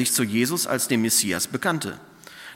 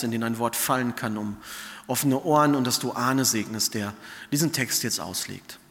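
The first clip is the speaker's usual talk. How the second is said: prayer; 3 dB quieter than usual. Real, not acted. Prays before the sermon.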